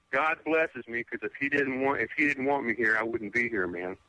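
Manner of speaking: usual speaking voice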